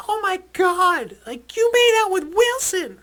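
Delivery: high, loud, slow mock girly squeal; party girls yelling at Wilson, high mock shriek; imitating shrieking girls. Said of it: "these girls are yelling".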